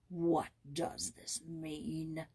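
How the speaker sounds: low, slow and stern; severe. A narrator's reading. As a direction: demand it sternly. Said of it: "he asked severely".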